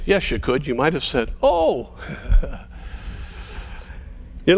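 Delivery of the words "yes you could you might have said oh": ordinary delivery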